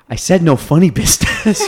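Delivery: can't hold it together mid-quote; laughing through the quote. Retold or imitated. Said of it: "Angela insisting, laughing".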